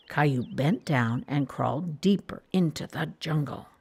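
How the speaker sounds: ordinary delivery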